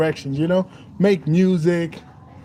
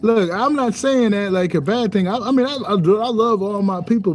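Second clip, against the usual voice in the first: ordinary delivery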